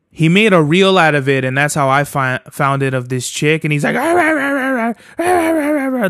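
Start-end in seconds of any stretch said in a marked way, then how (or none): 4.0-6.1 high-pitched rapid yappy "rah-rah-rah"; imitated chihuahua yapping. Podcaster mocking how a woman sounded, high chihuahua-like yapping. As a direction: mimic a little dog's yapping, pitched way up. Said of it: "like a chihuahua".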